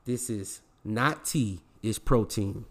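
not slowed and leaned on, then it's protein; slow, weighty "This is not tea"; stress on not. Real, not acted. Podcaster signing off to listeners, slow and emphatic. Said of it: "And remember this".

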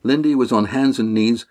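plain reading voice

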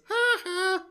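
very high two-note mocking laugh; Nelson. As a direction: imitate a cartoon bully's jeering "ha ha". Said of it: "Nelson walks by a mirror and he goes".

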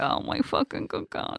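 whispered aside in mock dread; horrified mock whisper. Parent dreading kids in five-star hotel, horrified mock whisper. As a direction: whisper it under your breath, appalled.

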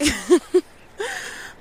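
loud high laugh. Real, not acted.

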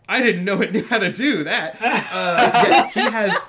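cracking up mid-sentence; can barely get the words out for laughing. Real, not acted. GM to players about the horse, laughing hard.

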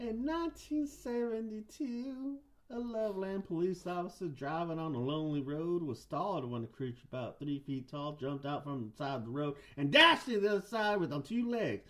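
high-pitched Southern narrator; Southern guy. Pretend. Host tells police officer's frog sighting, Southern accent. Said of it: "I'll do a Southern guy".

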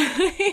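short high giggle; light amused laugh. Real, not acted. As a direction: giggle at your own remark. Laughs about boyfriend.

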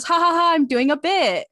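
high, loud mock laugh and protest; hammed-up imitation with a fake ha-ha; Jack. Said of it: "and then Jack goes".

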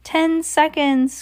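slow, high countdown cry. A narrator's reading. Grand High Witch counting down, high drawn-out cry. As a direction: stretch it out high for suspense. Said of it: "cried the Grand High Witch".

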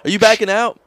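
high loud road-rage pilot; angry pilot yelling. Acted. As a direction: yell at the other plane.

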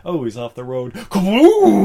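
building to a loud high Kaboom; mock crash. Host acting out the Jeep crash, ending in a loud kaboom. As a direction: blurt the explosion sound big. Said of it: "It was just like".

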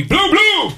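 high-pitched mimicked double thud; imitated tumbling thuds. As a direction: sound out a tumble in high, punchy bursts. Imitating baby tumbling off him.

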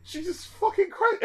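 high, stunned gasp of disbelief; disbelieving exclamation; aghast. Retold or imitated. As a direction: squeak it out in horror. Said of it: "I'm like".

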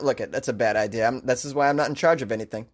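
ordinary talk

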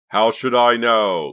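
loud, high, drawn-out angry outburst; wild interruption. A narrator's reading. Boy cuts off his accuser, wildly defensive. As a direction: cut in, exploding with exasperation. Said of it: "Clay interrupted wildly".

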